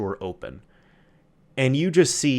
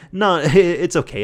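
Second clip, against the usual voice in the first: laughing reassurance; 6 semitones higher, 5 dB louder, slower than usual. Retold or imitated. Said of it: "she essentially said".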